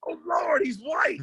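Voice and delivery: high mock shout of surprise; startled older woman in the crowd, shouting in shock; shocked lady. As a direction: squeal it like a stunned church lady. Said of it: "One lady goes".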